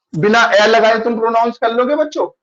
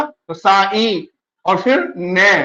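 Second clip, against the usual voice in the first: slow sounding out; much slower than usual. Shown as a demonstration. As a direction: split the name into its sounds, slowly. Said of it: "ई ऐसे ही लिखा हुआ है मात्रा में". (धीरे-धीरे हर ध्वनि अलग बोलना)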